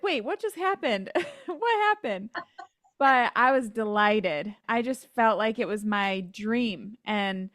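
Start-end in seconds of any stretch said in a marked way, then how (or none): 0.0-2.3 high, baffled and laughing; mock bewildered laugh; surprised, laughing. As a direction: replay the confusion with a giggle. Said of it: "And I was like".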